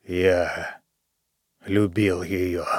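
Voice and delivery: low, breathless rasp; gasping, hoarse. A narrator's reading. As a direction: wheeze it out short of breath. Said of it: "Задыхаясь, Джо прохрипел".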